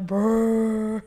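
loud monster rumble; Frankenstein growl. Retold or imitated. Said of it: "he'll literally go like".

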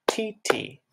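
"T, T." light, high and quick; eighth note sounds. Shown as a demonstration. Music teacher showing how eighth notes sound. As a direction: tap out "T, T." as two short beats. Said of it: "in music class we say".

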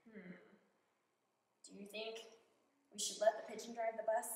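ordinary delivery, nothing marked

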